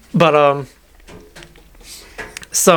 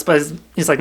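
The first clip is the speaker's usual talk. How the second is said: usual speaking voice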